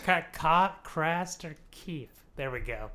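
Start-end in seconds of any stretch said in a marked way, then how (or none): 0.8-2.1 slow, separated CRASTER KEEP; careful enunciation. Real, not acted. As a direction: say "Craster Keep" slowly, word by word. Careful repeat after fumbling the name.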